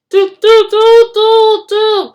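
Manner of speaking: very high, very loud horn blasts; bellowing toots. A narrator's reading. Bertie the bus bellowing to halt the train. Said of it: "bellowed Bertie".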